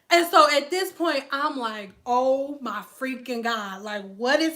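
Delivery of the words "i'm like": ordinary delivery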